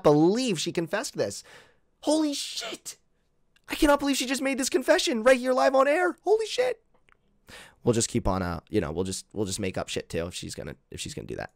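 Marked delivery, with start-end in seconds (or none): 2.0-6.8 much higher mock amazement; sarcastic mock shock. Streamer mocking the claimed confession to chat. 7.8-11.5 lower, softer, trailing off; muttered sarcasm. Real, not acted. Streamer muttering sarcastically after mocking the accuser.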